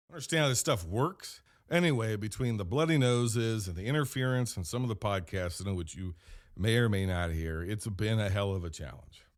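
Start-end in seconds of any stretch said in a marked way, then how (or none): none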